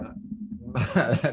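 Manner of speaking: short laugh. Real, not acted. Breaks into laugh mid-intro.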